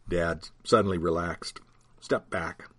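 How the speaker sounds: ordinary delivery, nothing marked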